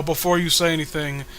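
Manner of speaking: usual speaking voice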